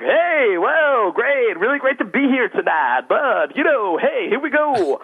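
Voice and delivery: Steve Martin impression. Speaker mimics his young self on stage doing Steve Martin. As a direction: ham up a goofy stand-up opener. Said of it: "talking like Steve Martin".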